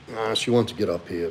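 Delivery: ordinary delivery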